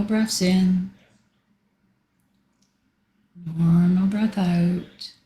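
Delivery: slow, drawn-out breathing cues; long stretched words, unhurried pauses between; guided breathing. Real, not acted. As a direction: lead a group breath slowly. Leads guided breathing exercise.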